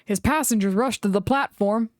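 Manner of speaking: ordinary delivery, nothing marked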